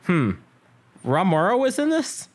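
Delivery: high, puzzled question rising steeply; incredulous. Retold or imitated. Recalling own reaction to the film, incredulous. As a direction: climb up in disbelief at the casting.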